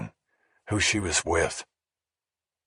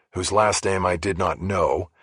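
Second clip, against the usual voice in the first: plain reading voice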